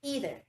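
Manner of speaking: "Either" said as "EE-ther"; American pronunciation. Shown as a demonstration. Teacher repeats American EITHER for the class, starting "ee". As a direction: start "Either" with a long ee, end on r. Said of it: "In American English, it is pronounced as".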